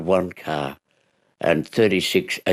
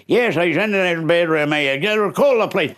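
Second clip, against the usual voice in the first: higher, urgent reply; husband tells wife to call the police; husband answering; 12 semitones higher than usual. Retold or imitated. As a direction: tell her to phone the police.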